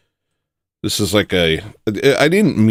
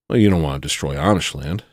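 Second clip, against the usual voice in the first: ordinary talk, nothing marked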